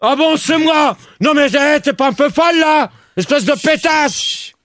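high, indignant insult; real angry outburst. Real, not acted. Host insults staffer who blamed him, angry outburst. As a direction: lash out in outraged disbelief.

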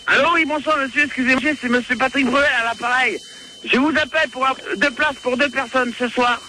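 normal talking voice

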